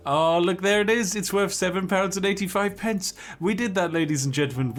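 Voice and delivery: high, loud burst of delight; gleeful triumph at the result; excited. Real, not acted. Proud cucumber price reveal.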